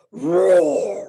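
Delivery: big loud growling roar; lion roar. Shown as a demonstration. Teacher demonstrates the lion roar for preschoolers, loud. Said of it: "we'll do it just like this".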